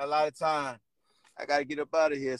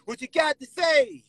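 shouted taunt; 11 semitones higher, much slower than usual. Retold or imitated. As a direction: yell it across the room. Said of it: "I said".

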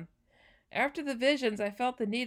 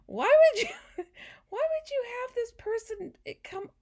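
high laughing disbelief, near tears; 13 semitones higher, 5 dB louder than usual. Real, not acted. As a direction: laugh through the words. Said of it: "My tears".

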